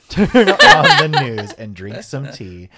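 loud laughing read, slow; laughing while reading. Real, not acted. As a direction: laugh through the lyric.

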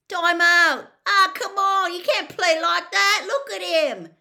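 high, very loud protest; indignant match-crowd holler; outraged fan shouting. Acted. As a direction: yell at the referee from the stands. Angry fan protesting at referee.

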